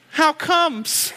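put-on high, sly little-kid question; kid's comeback. A five-year-old outsmarting his father, high childlike voice. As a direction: ask it like a clever child. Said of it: "And he goes".

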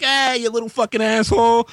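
put-on high-pitched jeering kid voice; bratty kids. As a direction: taunt like a mouthy child. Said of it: "They're like".

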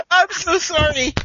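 real giggle-strained sorry; helpless laughing apology. Real, not acted. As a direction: apologise while cracking up. Apology straight after laughing fit.

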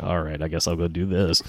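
soft, bored, half-hearted sigh; indifferent. Retold or imitated. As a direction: shrug it off lazily. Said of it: "lets things happen".

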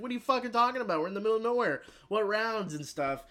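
high-pitched incredulous protest; mock outrage. Patient challenging his captor's excuse, mock outraged. Acted argument of panicked patient.

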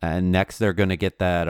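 ordinary talk

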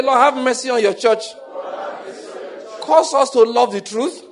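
loud, high pleading prayer chant; fervent prayer. Real, not acted. Pastor leading the church in prayer, loud fervent pleading. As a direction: cry out for mercy over and over.

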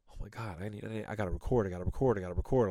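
low, hurried mock panic; retold freak-out. Host recalling freaking out over missed recordings, low retold panic. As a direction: repeat it quickly under your breath, mock-fretting. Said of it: "I was freaking out".

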